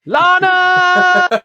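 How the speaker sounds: very high, loud cartoon yell; Archer shout. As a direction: belt the name like the Archer bit. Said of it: "Archer. ... that is an Archer reference".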